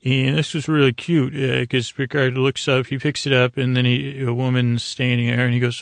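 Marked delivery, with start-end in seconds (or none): none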